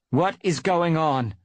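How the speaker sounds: high, loud, slow demand for answers; stern demand. A narrator's reading. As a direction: confront the warriors with slow authority. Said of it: "demanded Bluestar".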